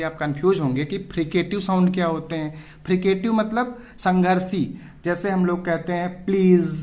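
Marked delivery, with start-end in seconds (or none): 6.2-6.7 "प्लीज" ending on a buzzing z; buzzing fricative. Shown as a demonstration. Teacher giving an example of fricatives, "प्लीज" with buzzing z. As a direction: let the end of "प्लीज" buzz. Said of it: "जहां पे buzzing sound आती है".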